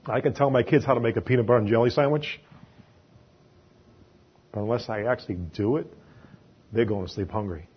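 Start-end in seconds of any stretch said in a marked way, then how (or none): none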